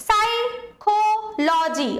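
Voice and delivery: Psychology very slow, with কো stressed. Shown as a demonstration. Teacher demonstrating word stress to students, slow stress on কো. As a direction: break up psychology and lean hard on কো. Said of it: "কোয়ের ওপর জোর বেশি পড়ছে".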